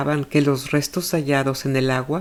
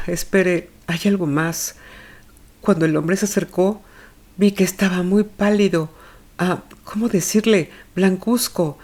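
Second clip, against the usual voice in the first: distressed mother; 5 semitones higher than usual. Acted. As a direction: plead helplessly, as a mother who blames herself. Olga in distress, self-blaming.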